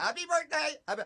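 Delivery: high put-on catchphrase; high, soft mock greeting; birthday bit guy. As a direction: imitate an oddball street greeter, pitched high. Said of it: "do you remember your bit".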